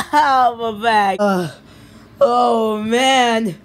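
hammed-up sore back after the climb; mock aching groan. The kid complains about his back in a mock groan.

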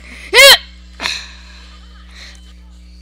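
loud, high startled yelp. Real, not acted. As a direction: yelp sharply as if struck. Cry when attacked.

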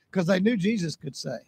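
normal talking voice

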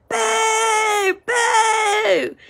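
high, wailing boo; mock booing heckler. Man in the stands booing Jalen, high wailing mock jeer. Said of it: "he's just going".

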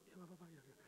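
very soft resigned murmur; hushed servant. Acted. Servants whispering about the older brother, very soft.

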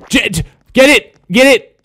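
high, excited calling out; urgent urging. Real, not acted. Player chasing the ball, urgent yell. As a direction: urge a teammate onto the ball. Urging teammates during play.

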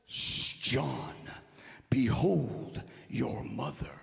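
Jesus to a disciple, low and slow; Jesus to John. Acted. Preacher voices Jesus addressing John, low and solemn. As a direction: drop low and space out each phrase. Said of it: "he turns to John and says".

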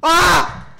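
high sudden yelp; startled yelp. Real, not acted. Gamer yelping at a sudden scare. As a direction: yelp in fright.

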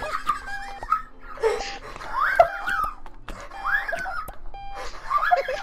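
long, high, wheezing laughing fit; helpless laughter. Real, not acted. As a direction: collapse into squealing laughter until out of air. Breaks down laughing at the game.

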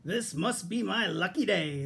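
slow, gleeful fox exclamation; mild shout. A narrator's reading. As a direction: exclaim it slowly, savouring the luck. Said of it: "the fox shouted".

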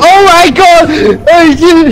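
very loud, high screams; shrieking. Real, not acted. Player shrieks as monster strikes. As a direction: shriek in panic then laugh.

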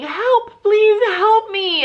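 high, loud, slow pleading cry for help; retold scream for help. A fallen rider calls for help from the ground, high and loud.

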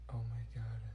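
whispered "oh my god". Acted. Student whispering to a classmate after the noise. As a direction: breathe it out in shock.

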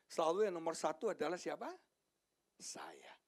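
usual speaking voice

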